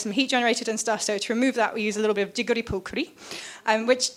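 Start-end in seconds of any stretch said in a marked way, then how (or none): 2.3-3.1 Welsh accent. Shown as a demonstration. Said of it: "that was a Welsh accent".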